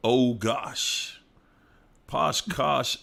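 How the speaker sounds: ordinary talk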